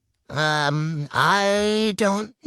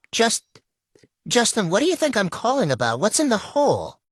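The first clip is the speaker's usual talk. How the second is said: higher, urgent, alarmed questioning; 7 semitones higher than usual. Acted. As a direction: demand answers, rattled. RA alarmed, pressing the student.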